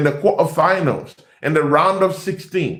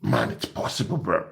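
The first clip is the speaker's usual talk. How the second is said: mock whispered concession; 8 semitones lower, 12 dB quieter than usual. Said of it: "They're like".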